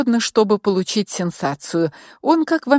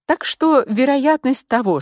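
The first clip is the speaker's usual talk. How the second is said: plain reading voice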